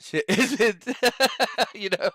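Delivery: slow words broken by laughter; laughing. Real, not acted. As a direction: crack up mid-sentence. Cracking up mid-sentence.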